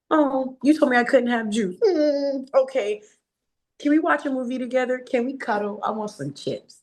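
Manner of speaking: put-on high, sweet little kid voice; sing-song toddler asking nicely. Mimicking her toddler's sweet requests.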